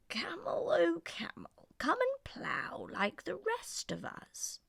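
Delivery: slow, high whispered ox voice; whispering ox. Acted. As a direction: breathe it out slowly like a weary ox. Ox voiced in story.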